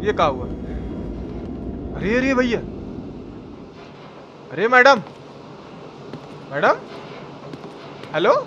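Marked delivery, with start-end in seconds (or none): none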